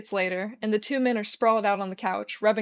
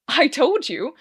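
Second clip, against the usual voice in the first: mouthful; 5 semitones higher, 7 dB louder, slower than usual. A narrator's reading. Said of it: "says with a mouthful of his second biscuit".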